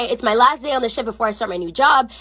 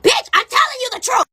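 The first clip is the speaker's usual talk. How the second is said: high, emphatic shout; exasperated shout; 11 semitones higher than usual. Retold or imitated. Server to disbelieving friend, exasperated shout.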